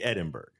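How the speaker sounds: "Edinburgh" ending like "iceberg"; insisted mispronunciation. Shown as a demonstration. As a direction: say "Edinburgh" as written, with a hard g. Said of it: "it clearly should be Edinburgh".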